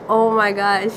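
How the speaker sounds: normal talking voice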